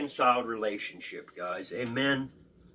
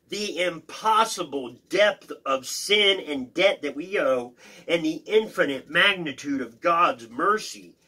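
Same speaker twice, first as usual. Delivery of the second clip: raised, deliberate teaching read; emphatic reading aloud; 6 semitones higher, 4 dB louder than usual. Acted. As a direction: read it out with weight on each key phrase. Reads commentary aloud.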